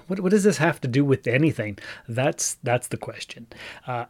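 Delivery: ordinary talk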